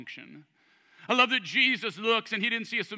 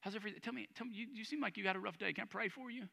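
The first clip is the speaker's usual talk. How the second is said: very soft, caring approach to a stranger; gentle concern; 13 dB quieter, much faster than usual. Acted. Preacher acting out Jesus offering prayer, gentle and hushed. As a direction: check on them softly, tentatively. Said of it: "Jesus would go".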